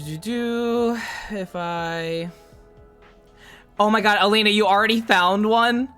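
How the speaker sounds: ordinary talk, nothing marked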